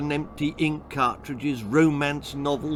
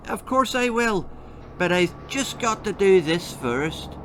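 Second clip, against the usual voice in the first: a bright, higher, airy brush-off; Bessie's breezy promise; 7 semitones higher than usual. A narrator's reading. Girl chirping to her nagging mum, breezy and high. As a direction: sound eager while dodging the job.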